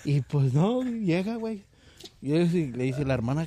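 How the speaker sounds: ordinary talk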